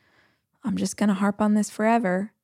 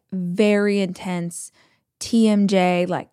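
normal talking voice

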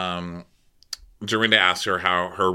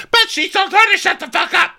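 much higher, louder squeal; hammed-up high protest; high mock protest; 6 dB louder than usual. Brandy voiced in recap.

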